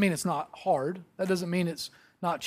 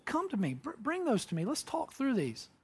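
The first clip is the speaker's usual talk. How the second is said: soft, higher and tender; gentle inviting; 6 semitones higher, 8 dB quieter than usual. Retold or imitated. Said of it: "he goes".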